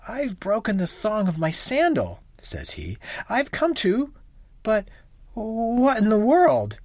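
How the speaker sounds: a hint of a startled young husband; husband coming home, lightly surprised; husband's voice. A narrator's reading.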